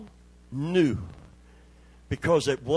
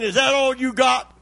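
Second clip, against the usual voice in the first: high, loud taunting devil; scornful devil shouts at the covenant people; mocking devil; 10 semitones higher, 5 dB louder than usual. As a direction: jeer like the devil, sneering at the boy. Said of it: "hear the devil saying".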